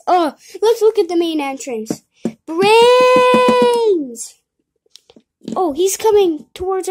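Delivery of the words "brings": long, loud call with a falling end. Acted. Kid calling after the character on camera, long loud call. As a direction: call the name across the yard.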